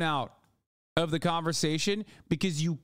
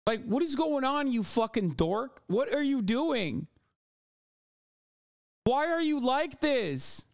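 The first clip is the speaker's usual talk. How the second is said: higher, slow incredulous questions; exasperated disbelief; 8 semitones higher than usual. Real, not acted. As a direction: plead at him in disbelief. Exasperated rant at politician.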